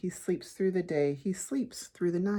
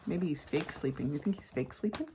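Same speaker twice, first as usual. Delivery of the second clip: plain reading voice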